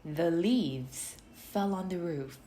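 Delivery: ordinary delivery, nothing marked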